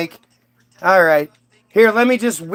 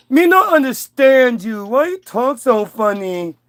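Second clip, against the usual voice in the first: dim caveman Adam; 3 semitones higher than usual. Acted. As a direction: stretch it out like a baffled caveman.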